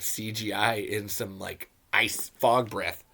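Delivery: ordinary talk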